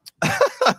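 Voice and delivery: very high brief giggle. Real, not acted. Guest giggling about lonely years, very high. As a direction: let out a squeaky giggle.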